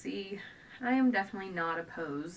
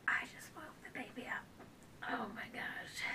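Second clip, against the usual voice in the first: whisper; 5 semitones higher, 8 dB quieter than usual. Real, not acted. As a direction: drop to a breathy whisper. Whispers after waking the baby.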